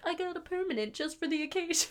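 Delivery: lightly hammed lion boast; lion voice impression. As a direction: mimic the lion's proud line softly. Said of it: "he's like".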